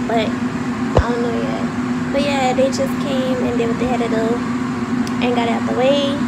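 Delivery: loud, fast, so the phone hears; talking loud. Real, not acted. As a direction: raise the voice over the noise. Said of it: "but I'ma talk loud".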